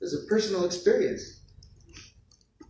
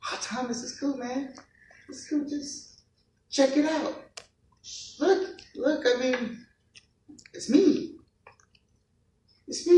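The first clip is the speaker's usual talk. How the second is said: much higher and much softer, gentle and soothing; tender Jesus voice; 7 semitones higher, 5 dB quieter than usual. Acted. Jesus soothing the doubting Thomas, soft and high. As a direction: coax a grieving friend quietly, lifting the pitch. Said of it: "this loving and caring and compassionate God, says".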